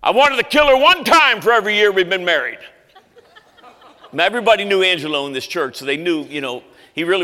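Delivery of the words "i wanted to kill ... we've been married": louder, higher gruff deadpan jab; retold husband's deadpan crack at the microphone; gruff old man quote. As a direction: deliver his joke like a blunt old rigger. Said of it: "Here's what he said".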